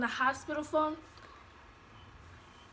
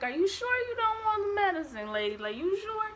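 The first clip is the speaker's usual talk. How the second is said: high-pitched insistent questioning, imitated; doubtful nurse; 9 semitones higher than usual. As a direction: mimic a nurse double-checking in disbelief. Lady questioning her choice, acted.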